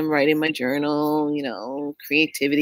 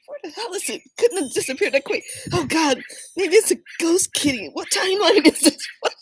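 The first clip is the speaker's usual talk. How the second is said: giggly bewilderment; 12 semitones higher than usual. Retold or imitated. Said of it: "I'm like".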